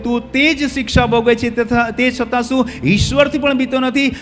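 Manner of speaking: high, loud, drawn-out reproof; stern rebuke. Acted. Thief scolds his fellow for not fearing God, stern rebuke. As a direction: rebuke forcefully, stretching the key words.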